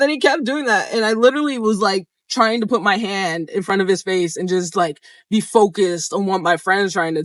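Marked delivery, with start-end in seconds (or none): none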